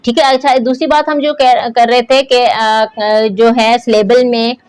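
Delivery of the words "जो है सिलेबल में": ordinary talk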